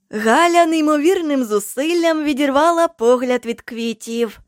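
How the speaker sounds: ordinary delivery, nothing marked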